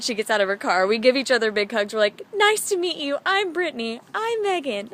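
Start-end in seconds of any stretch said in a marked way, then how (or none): 2.3-4.9 high-pitched, excited greeting; gushing introductions. Retold or imitated. Two new friends meeting with big hugs, high gushing greeting. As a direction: gush it high, imitating a happy first meeting.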